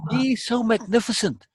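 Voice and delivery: put-on high-pitched, awestruck boy; entranced boy. A narrator's reading. Boy staring at the Emperor, entranced. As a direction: marvel in a high boy's voice. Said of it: "The boy is entranced".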